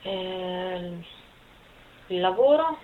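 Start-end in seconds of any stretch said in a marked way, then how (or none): none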